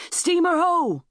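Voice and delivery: high, drawn-out sighting call; long, high-pitched sailor's hail; lookout's shout. A narrator's reading. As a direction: cry it out from the masthead. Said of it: "Then a shout came from the lookout".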